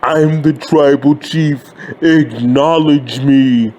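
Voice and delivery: Roman Reigns impression. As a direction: drop the voice and draw out a self-important decree. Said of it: "Roman Reigns on SmackDown talking".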